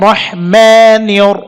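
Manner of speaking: রহমানিয়র said slowly with a heavy র. Shown as a demonstration. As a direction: stretch রহমানিয়র, thicken the র. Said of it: "এখানেও নিয়র বলতে হবে". (মোটা র দিয়ে ধীরে)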